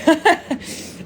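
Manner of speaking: high-pitched burst of laughter; amused laugh. Real, not acted. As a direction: laugh at your own slip. Amused by own word attitude-y.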